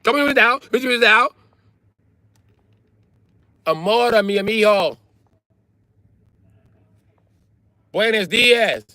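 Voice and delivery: very high-pitched polyglot caricature; mock multilingual woman. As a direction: chirp greetings in one language after another. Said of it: "They speak, like, nine languages".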